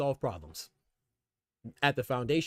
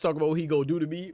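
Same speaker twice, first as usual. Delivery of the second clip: ordinary talk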